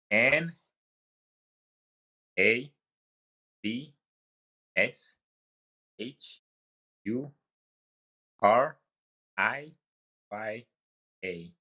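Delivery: letter by letter, each one lifted with a pause after; list-like rising letters, spaced well apart; spelling out. Shown as a demonstration. Spelling his surname on request.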